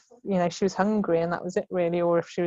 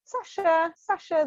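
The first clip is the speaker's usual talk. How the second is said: calling a colleague; 10 semitones higher, slower than usual. Retold or imitated. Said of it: "hear in someone's tone of voice".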